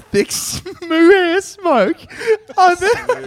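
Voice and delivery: laughing too hard to read. Real, not acted. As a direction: voice cracking with laughter. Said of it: "I can't stop laughing".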